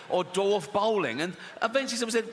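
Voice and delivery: usual speaking voice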